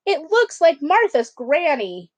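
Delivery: offended roar. Acted. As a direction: keep the outrage going. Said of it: "he roared".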